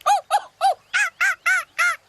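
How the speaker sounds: loud, very high bird laugh; piercing cartoon kookaburra call. A narrator's reading. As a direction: hoot "oh oh", then cackle "ah ah". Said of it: "laughed Cookie Kookaburra".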